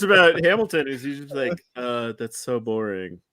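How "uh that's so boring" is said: low, slow, soft and unimpressed; mock boredom. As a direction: sigh it out as if imitating a jaded expert. Imagined unimpressed reaction of Hamilton.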